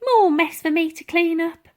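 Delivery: cleaning lady grumbling at the flood; old cleaning lady. Acted. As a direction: tut over the mess. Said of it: "remarked Dotty".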